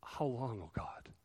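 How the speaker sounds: faint, breathless whisper of a plea; hushed desperate whisper; whispered cry. Retold or imitated. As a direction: whisper it like a weary cry to God. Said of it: "they're crying out".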